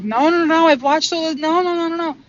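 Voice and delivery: high, loud rapid string of no's; loud refusal. Retold or imitated. Refusing her oversoul's order to release, loud and high. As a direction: push back hard in protest. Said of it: "And I'm like".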